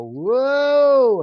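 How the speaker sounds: long wailing "Whoa" pitched very high and loud, like a siren. Shown as a demonstration. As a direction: wail it out like a siren, in harmony. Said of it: "like a siren".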